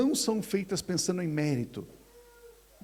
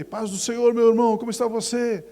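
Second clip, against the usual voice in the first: louder, bright pastoral hello; cheerful greeting; 5 semitones higher, 6 dB louder than usual. Retold or imitated. Pastor greeting a church member, loud and cheerful. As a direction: greet him with energy. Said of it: "Aí você cumprimentou".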